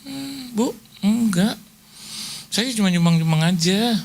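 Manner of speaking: high, hurried pleading "Bu, Bu, jangan libatkan saya"; mock the woman backing out in a panic; panicky high voice. Imitating the donor refusing on the phone, high and panicky. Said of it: "Belum ngomong lebihnya".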